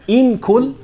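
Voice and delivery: high, loud "In kul" with a held nasal n; hidden noon drill. Shown as a demonstration. Teacher leading students to repeat, "In kul" with hidden noon. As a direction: hum the n of "In kul" into the k. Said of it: "let's do the first one".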